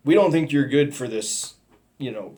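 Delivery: normal talking voice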